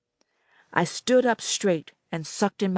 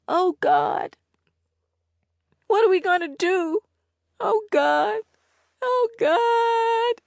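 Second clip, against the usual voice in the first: much higher, rising in panic; panicking girl; 3 dB louder, slower than usual. A narrator's reading. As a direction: spiral into fear. Annette panics.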